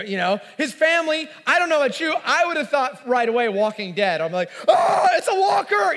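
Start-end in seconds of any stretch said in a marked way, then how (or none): 4.7-6.0 high, loud mock scream of alarm; creeped out. Imagining seeing Lazarus walk out like Walking Dead, mock-terrified yelp. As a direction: yelp as if spotting a zombie. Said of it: "I'm creeped out by that".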